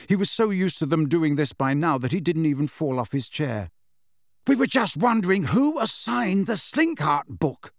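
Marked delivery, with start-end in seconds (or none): 4.5-7.7 light, higher casual chat; conversational. A narrator's reading. Fred asks Harry about a book, conversational. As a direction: toss it out conversationally, pitched up. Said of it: "said Fred conversationally".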